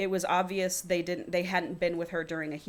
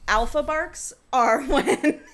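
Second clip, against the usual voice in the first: slow, higher, laughing through it; 8 semitones higher, 8 dB louder, slower than usual. Real, not acted. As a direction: giggle while explaining.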